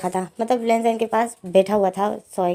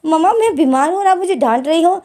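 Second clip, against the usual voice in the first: put-on higher, louder childish whine; whiny protest; 7 semitones higher, 4 dB louder than usual. Lenzen whines to mother that she scolds him while sick. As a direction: complain like a sulking sick kid.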